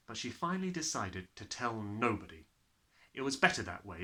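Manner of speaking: ordinary delivery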